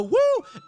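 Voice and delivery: high, loud party whoop; party woo. Retold or imitated. Pretty person in the back whoops loudly, high party yell. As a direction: whoop like someone having fun. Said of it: "hear someone go".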